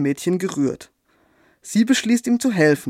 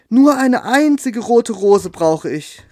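high, slow, louder plea of the nightingale; a desperate little bird crying out; pleading call; 5 semitones higher, 5 dB louder, slower than usual. Acted. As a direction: beg for the one red rose, calling out slowly. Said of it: "rief die Nachtigall".

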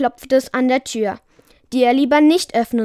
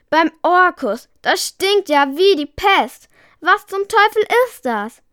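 disgusted exclamation; 7 semitones higher than usual. A narrator's reading. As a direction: recoil at a horrible stink. Wizard exclaims at the stench.

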